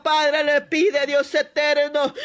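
ordinary talk

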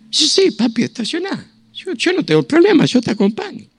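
high eager wife's voice; wife eagerly agreeing to go along, high voice. Retold or imitated.

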